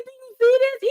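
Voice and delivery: high, loud thrilled cry; excited shriek. Retold or imitated. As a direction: squeal it, overjoyed. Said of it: "I said".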